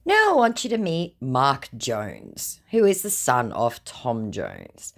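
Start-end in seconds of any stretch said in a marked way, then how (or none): none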